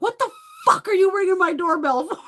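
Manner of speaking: high, loud mock-furious yell; full-volume outraged holler, acted out; screaming out window. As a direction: scream it to scare off whoever's below. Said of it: "I'll just scream something out the window".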